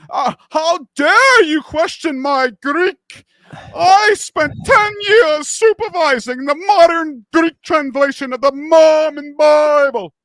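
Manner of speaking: high, slow indignant shout; mocking pompous scholar. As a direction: ham up an outraged academic defending his credentials.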